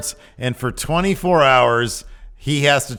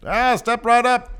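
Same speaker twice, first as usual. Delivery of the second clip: put-on much higher old-timey barker call; carnival barker; 12 semitones higher, 4 dB louder than usual. Carnival barker calling crowd.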